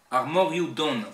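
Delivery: Breton verse with strong Cornouaille stresses; emphatic Cornouaille Breton recitation; Cornouaille Breton accent. Shown as a demonstration. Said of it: "l'accent cornouaillais".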